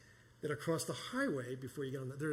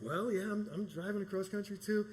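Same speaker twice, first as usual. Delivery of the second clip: higher stranger voice; 7 semitones higher, 4 dB louder than usual. Retold or imitated. Stranger's reply.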